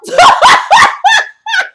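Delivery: loud, high shriek of laughter. Real, not acted. Vlogger howling at Sheree's comeback, a high shrieking laugh. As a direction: scream with laughter.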